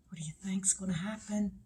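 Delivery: quick, soft hushed question; suspenseful whisper. Pretend. Suspense aside before ending.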